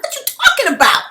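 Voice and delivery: angry disbelief. Real, not acted. Telling viewers about people in those rooms, angry and loud. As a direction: demand an answer, fed up. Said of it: "y'all see me getting angry".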